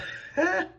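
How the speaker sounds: brief high chuckle; one small high-pitched laugh; short laugh. Real, not acted. Laughs after telling of injury.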